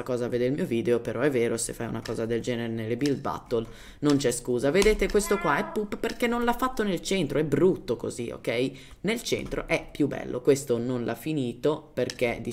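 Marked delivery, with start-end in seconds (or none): none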